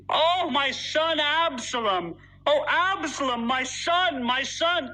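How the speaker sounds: slow, high-pitched wail of a grieving father; king crying out in grief. Acted. A king laments his dead son Absalom in a slow, high wail. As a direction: draw out each cry for the lost son, pitched high. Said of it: "the king cried out with a loud voice".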